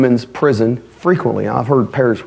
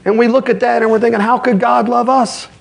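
high, driving sermon voice; raised, urgent pulpit delivery; fiery preaching; 12 semitones higher, faster than usual. Acted. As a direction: preach it hard and fast to the congregation. Preaching at full force.

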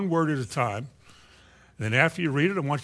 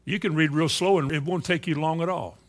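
usual speaking voice

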